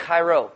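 "Chairo" as the original-language word, falling at the end; foreign-word pronunciation. Shown as a demonstration. Preacher showing the original word for greetings, foreign pronunciation. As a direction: pronounce "Chairo" as KHY-ro. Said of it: "It says".

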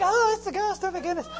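high, mock girlish squeal; hammed-up frightened little girl; little-girl fright. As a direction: squeal daintily, without shouting. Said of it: "They're not like little girls".

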